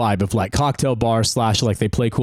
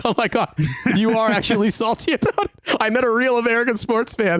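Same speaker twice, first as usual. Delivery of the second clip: high-pitched, imitated fan excitement; amazed fan; 9 semitones higher than usual. Young British café worker meeting an American fan, mock amazement. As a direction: act out a starstruck sports fan, pitched way up.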